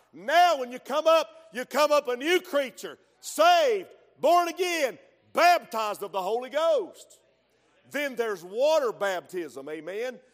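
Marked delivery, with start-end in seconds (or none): 0.1-7.2 higher and louder, building to a cry; rising preaching fervor. Real, not acted. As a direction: preach it up to a peak.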